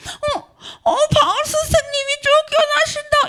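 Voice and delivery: high, startled gasp of disbelief; alarmed cry as Paul is driven out; shocked onlookers. Retold or imitated. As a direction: gasp it out like a stunned believer watching. Believers watching Paul expelled.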